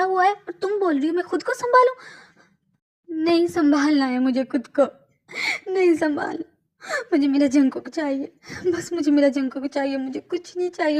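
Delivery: wife screaming at the nurse, grief-stricken; anguished outburst. Acted. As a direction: cry out that she wants him back. Wife breaks down, loud.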